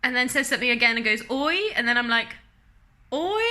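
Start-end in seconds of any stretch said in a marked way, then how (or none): 3.1-3.4 high, drawn-out questioning echo; clueless echo. Retold or imitated. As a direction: parrot it back with a rising, baffled lilt.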